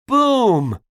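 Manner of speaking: high, loud boom, falling away; cannon boom. Acted. Narrator voices the very big cannon, loud boom. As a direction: blast it out like a cannon. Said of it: "went the cannon".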